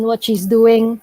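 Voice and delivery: usual speaking voice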